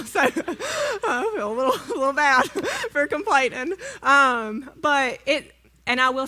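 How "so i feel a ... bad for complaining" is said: laughing through the words, voice teary; laughing sheepishly. Real, not acted. To the congregation, guilty about her time off, laughing. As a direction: giggle it out, a little embarrassed.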